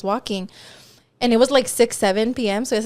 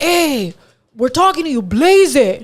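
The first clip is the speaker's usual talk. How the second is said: raised, insistent mock heckle; imitating a pushy street harasser; pushy catcall; 4 semitones higher, 5 dB louder, slower than usual. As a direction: call out louder to someone ignoring you. Retold catcallers getting pushy.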